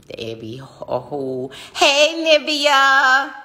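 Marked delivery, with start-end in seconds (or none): 1.7-3.3 loud, high shout-out with a stretched name; high, drawn-out happy holler; excited greeting. Real, not acted. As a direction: holler hello to a friend who just popped in. Greets a viewer joining the live.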